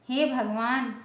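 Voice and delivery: usual speaking voice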